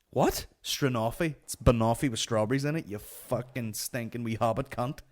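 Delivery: normal talking voice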